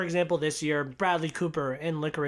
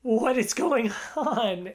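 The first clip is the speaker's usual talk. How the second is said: high, slow, drawn-out disbelief; hammed-up baffled exclamation; overwhelmed bewilderment; 6 semitones higher, slower than usual. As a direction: stretch it out, mock-overwhelmed. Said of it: "I have so many emotions".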